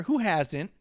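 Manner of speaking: ordinary delivery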